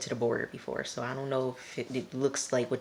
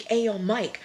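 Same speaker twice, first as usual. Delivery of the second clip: louder, higher mock call; 6 semitones higher, 6 dB louder than usual. Ruby hails Mike before shooting, calling out. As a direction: imitate someone hailing a man.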